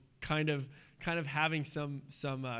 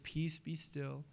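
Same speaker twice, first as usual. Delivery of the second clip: soft slow command; 8 dB quieter, slower than usual. Retold or imitated. Jesus calming the storm.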